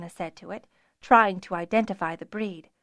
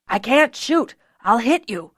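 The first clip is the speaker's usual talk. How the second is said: shouted; 2 semitones higher, 5 dB louder than usual. A narrator's reading. Archer unable to shoot past Fiona, loud alarmed shout. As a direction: shout a frantic warning. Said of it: "the archer shouted".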